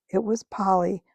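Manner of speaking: plain reading voice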